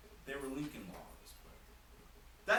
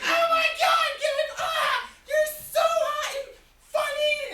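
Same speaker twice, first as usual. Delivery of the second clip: put-on much higher, loud shrieking girl, slow on "so hot"; squealing fangirl; 15 dB louder, slower than usual. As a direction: scream it like a swooning fan. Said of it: "then they'd be like".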